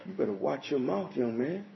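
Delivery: stern warning. Retold or imitated. Warning an imagined scoffer.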